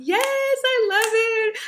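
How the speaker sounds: high, loud, drawn-out delighted squeal; excited squeal. Real, not acted. Host thrilled by the viewers' comments, squealing with delight.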